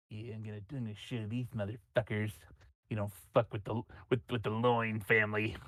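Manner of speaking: low menacing mutter. Acted. GM voices unknown mind's threat.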